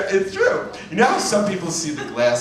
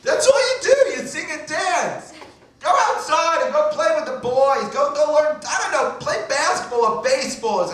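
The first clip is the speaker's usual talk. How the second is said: very high, exasperated mock rant; screaming mother; 13 semitones higher than usual. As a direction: send the kid outside like a fed-up mother. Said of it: "she's always screaming".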